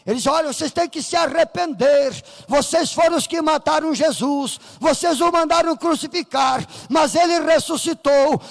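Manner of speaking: ordinary delivery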